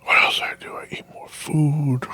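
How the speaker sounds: whispered sheepish admission; whispered aside. Real, not acted. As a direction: confide it in a whisper. Whispered aside recalling trip.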